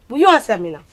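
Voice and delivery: usual speaking voice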